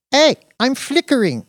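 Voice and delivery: put-on high, bright foreign girlfriend. A narrator's reading. Dina complains.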